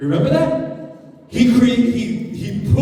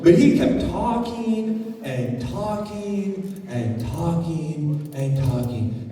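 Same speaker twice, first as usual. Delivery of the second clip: dragging on; 4 semitones lower, slower than usual. Shown as a demonstration. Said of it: "See how I'm changing my voice there?".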